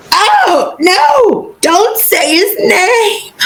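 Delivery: high, drawn-out mock panic; Dobby. House-elf hearing the forbidden name, panicked high cry.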